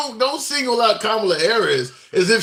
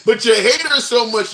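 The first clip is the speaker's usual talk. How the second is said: ordinary talk, nothing marked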